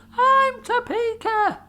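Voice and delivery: very high, slow called-out voice; calling out. Acted. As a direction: sing it out from far across. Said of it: "somebody else called".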